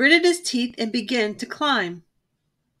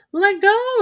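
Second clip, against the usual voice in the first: high, loud protest; 11 semitones higher, 5 dB louder than usual. A narrator's reading. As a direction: struggle to get free. Bully caught by the neck.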